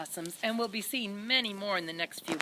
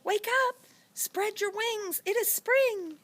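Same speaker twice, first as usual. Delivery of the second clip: excited call; 12 semitones higher than usual. Acted. Seed calls the ladybug.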